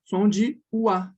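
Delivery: "Som de o A" slowly spelling out the ua sound; slow, careful demo of the oi sound. Shown as a demonstration. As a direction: draw out the ua like a teacher. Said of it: "a pronúncia já vai ficar assim".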